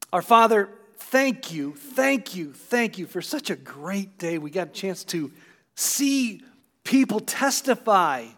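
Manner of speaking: a pastor giving thanks for the baptisms; prayer. Acted. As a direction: lift it up to God with gratitude. Closing prayer.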